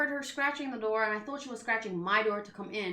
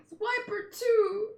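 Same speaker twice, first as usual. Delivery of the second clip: crying out; 10 semitones higher, 3 dB louder, slower than usual. Acted. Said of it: "And she cries".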